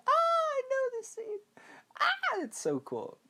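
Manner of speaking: very high, squealed excitement; mock fangirl squeal. Viewer recognizes the famous scene, mock excited squeal. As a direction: squeal it like a thrilled fan.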